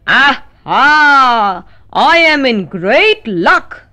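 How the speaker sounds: slow, pleased wolf voice; gleeful wolf. A narrator's reading. Wolf gloats to himself, slow and pleased. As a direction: relish the luck, lightly voiced wolf. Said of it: "said the wolf to himself".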